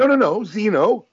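high, insistent correction; imitating Emily. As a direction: pitch up and correct him again. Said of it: "It's like".

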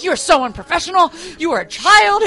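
loud, high exasperated telling-off; retold scolding. Woman berating the co-worker who ignores her, exasperated.

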